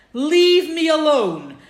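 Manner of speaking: a slowed, forceful divine dismissal; stern command. Retold or imitated. God telling Moshe, a slow forceful leave me alone.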